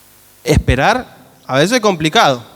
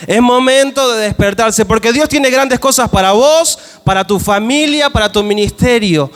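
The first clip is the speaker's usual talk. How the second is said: preaching at full force; 5 semitones higher than usual. Acted. Preacher urging the church to wake up, fervent and rising. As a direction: rouse the church awake.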